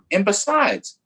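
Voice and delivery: usual speaking voice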